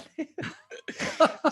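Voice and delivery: high breathy burst of laughter; amused laugh. Real, not acted. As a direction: laugh at your own joke. Laughs at own joke.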